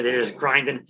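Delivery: ordinary delivery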